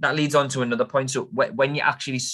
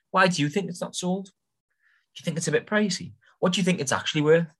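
friendly probing; 8 semitones higher than usual. Shown as a demonstration. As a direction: sound out the agent lightly, pitched up. Models asking the agent nicely.

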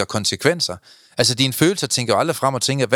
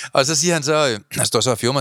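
ordinary talk

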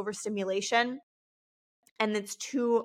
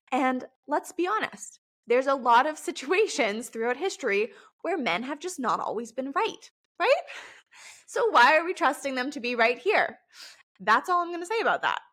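higher and louder, cheeky provocation; sassy mock jab; 4 semitones higher, 3 dB louder than usual. Speaker to women listeners, about trusting men, cheeky and louder. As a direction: tease it, then pretend to drop it. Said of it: "No, I'm just kidding".

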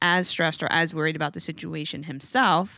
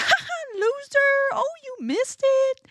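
very high squeaky gloating taunt; mock gloating. Shown as a demonstration. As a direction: gloat at the dog in play. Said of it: "I'm always like".

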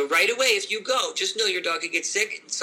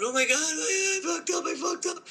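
hammed-up panic, much higher; mock panic; 11 semitones higher than usual. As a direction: play the frantic owner. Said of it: "rushed a dog to the vet going".

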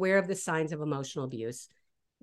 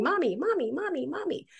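put-on high, pleading child's voice tugging for attention; be a small kid nagging mommy; whiny little kid; 9 semitones higher than usual. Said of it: "a little kid who's pulling on his mother's apron strings going".

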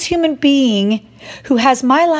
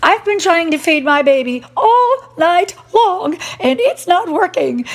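much higher, exasperated wail; frantic new mother fed up after a sleepless night; exasperated outburst; 8 semitones higher than usual. Retold or imitated. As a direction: pitch way up in frustrated disbelief. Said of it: "I'm like".

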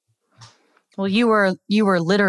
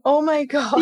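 much higher, laughing disbelief; real shocked laugh; 10 semitones higher than usual. Real, not acted. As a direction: react with stunned laughter. Listener reacts to mix-up.

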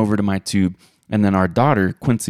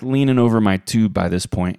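ordinary talk

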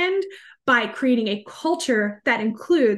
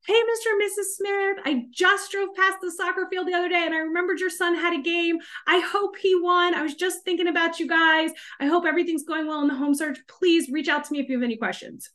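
second-year sales call; 5 semitones higher than usual. Acted. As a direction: play a salesperson checking in with clients. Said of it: "a year or two in, it might be like".

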